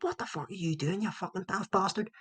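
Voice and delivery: high-pitched heckle at a player; mock angry shouting. Fan berating his own players at a football game, mock angry shouting. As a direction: re-enact a sweary terrace rant without full volume. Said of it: "even shouting at our own players".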